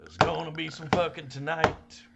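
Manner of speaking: hammed-up announcer voice. Shown as a demonstration. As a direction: ham it up like a hype man. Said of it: "repeats the line in a bigger voice".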